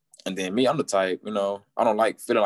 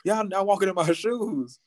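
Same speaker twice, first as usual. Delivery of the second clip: high, drawn-out indignant protest; going off; 12 semitones higher, slower than usual. Retold or imitated. Frustrated patient answering the nurse who says relax, going off. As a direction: re-enact a slow, high-pitched rant. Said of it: "I was going off".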